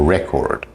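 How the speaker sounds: "record" as the noun, REC-ord; first-syllable stress. Shown as a demonstration. Teacher contrasting word stress, "record" stressed on REC. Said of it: "word stress".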